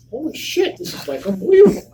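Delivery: high, fast, laughing amazement; amazed. Retold or imitated. Seeing his convention table, high excited amazement. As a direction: burst out in wonder.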